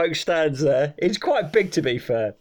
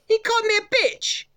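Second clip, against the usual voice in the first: very high-pitched Eastern European complaint; Eastern European woman. Retold or imitated. Cleaner complaining to garage owner, high mock Eastern European accent. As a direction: imitate an outraged cleaner, accent and all. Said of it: "I can do her voice".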